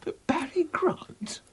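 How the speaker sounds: incredulous. Acted. Incredulous at pairing.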